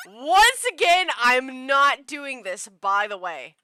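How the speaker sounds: emphatic refusal. Real, not acted. Player refusing to voice the scene, slow loud declaration. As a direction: announce it loudly, stretched out. Repeats refusal loudly over game.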